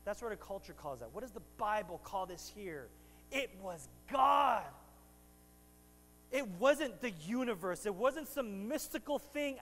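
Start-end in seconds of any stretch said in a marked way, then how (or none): 3.3-4.6 slow, loud proclamation; preaching shout. Real, not acted. Preacher tells the church it was God, shouting slowly. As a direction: thunder it out to the church.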